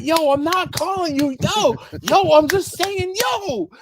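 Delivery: very high-pitched mock pleading; frantic protest. Protesting to the offended coworker, squeaky high exasperation. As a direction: plead innocence, voice climbing into a squeak.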